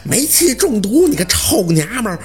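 hammed-up rage, peaking on the last order; enraged husband. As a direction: scream the accusation at your wife. Husband raging at wife.

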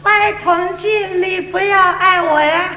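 Cantonese woman. Retold or imitated. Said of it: "他又跟我说了".

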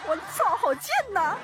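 laughing. Real, not acted. Laughs at her own act.

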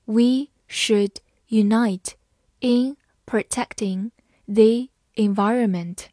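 slow start, word by word; slow reading. Shown as a demonstration. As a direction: read it slowly for learners. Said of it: "好，慢慢来读。". (慢速朗读)

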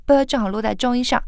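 ordinary talk, nothing marked

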